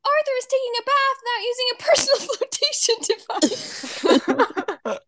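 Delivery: put-on high loud little girl, breaking into laughter; mock child voice cracking up; imitating a bossy sister through giggles; tattling kid, laughing.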